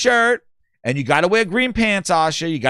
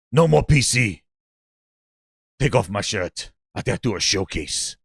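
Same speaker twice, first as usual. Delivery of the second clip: much lower mock boss voice; mock Herman; 8 semitones lower than usual. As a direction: drop low and play the boss. Herman quote retold.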